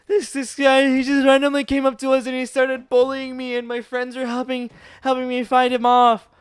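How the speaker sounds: high, loud tearful whine; mock crying. Crying boy blaming the bully to the teacher, mock wail. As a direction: blubber it out like a wailing child. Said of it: "I started crying".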